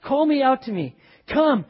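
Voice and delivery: loud, high call across the water; Peter calling out. Acted. Peter calling to Jesus over the storm, loud and high. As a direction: shout out to the Lord from the boat. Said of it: "He says".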